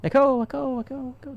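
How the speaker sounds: high echo fading away; imitated echo. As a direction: repeat it like an echo, dying down.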